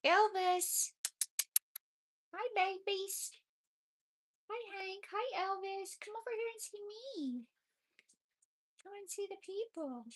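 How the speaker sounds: very high, sing-song voice for the babies. Real, not acted. Talking to the kittens in high baby talk.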